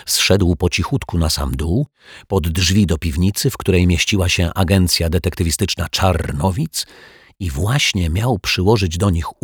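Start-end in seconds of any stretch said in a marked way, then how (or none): none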